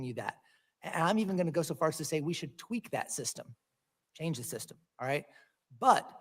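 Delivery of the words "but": sudden loud but. Real, not acted. Senator pressing his question.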